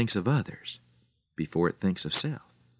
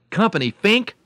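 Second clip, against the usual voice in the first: normal talking voice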